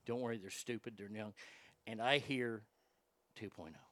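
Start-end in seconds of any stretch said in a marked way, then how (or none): 0.0-1.3 put-on soft, low reassurance into a crying girl's ear; hushed consoling words to the crying stepdaughter; soothing whisper. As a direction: murmur comfort so only she hears. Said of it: "I'm whispering into her ears".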